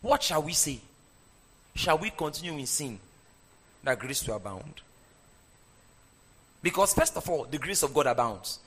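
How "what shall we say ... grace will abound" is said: hushed question. Acted. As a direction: lower and softer, fading almost away. Preacher varies the quoted question.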